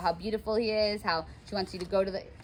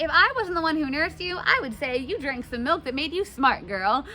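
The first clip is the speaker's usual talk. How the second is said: higher, louder teasing nurse; sassy paraphrase; 8 semitones higher, 5 dB louder than usual. Retold or imitated. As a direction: tease her like a cheeky nanny. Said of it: "She's like".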